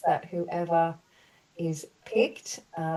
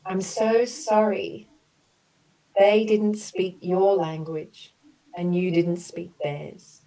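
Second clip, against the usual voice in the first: gentle, measured apology statements for the group; compassionate healing affirmations; 3 semitones higher, 3 dB louder than usual. Acted. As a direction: offer each sorry like a soothing balm. Leads group through healing apologies.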